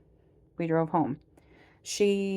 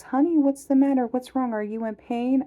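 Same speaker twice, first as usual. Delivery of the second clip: caring nurse, higher and louder; sweetly concerned nurse asking; a light impression of a caring nurse; nurse's voice; 8 semitones higher, 4 dB louder than usual. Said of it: "and she goes".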